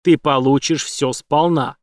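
usual speaking voice

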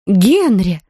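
loud-edged, shocked cry of a name; gasping in alarm. A narrator's reading. Wife hears a splash, cries out to husband, aghast. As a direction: call out with a startled gasp. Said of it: "так и ахнула миссис Браун".

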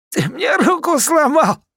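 very high, slow pained moan. A narrator's reading. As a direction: groan out the complaint. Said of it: "простонал Дуримар".

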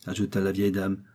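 ordinary delivery, nothing marked

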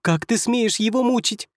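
high, piercing mouse-like squeak; squeaky pixie voice. Acted. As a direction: squeak it out like a tiny angry fairy. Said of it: "Тоненьким, как у мыши, пронзительным голоском пропищала".

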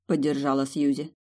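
ordinary delivery, nothing marked

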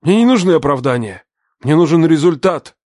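hard demanding father. Acted. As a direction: bark the demand.

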